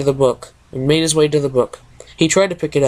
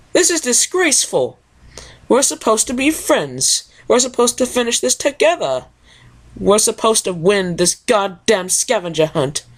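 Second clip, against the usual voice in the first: much higher, prim and affronted lady; Rarity voice; 7 semitones higher than usual. Acted. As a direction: ladylike scolding, pitched way up. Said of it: "my two favorite speaking parts".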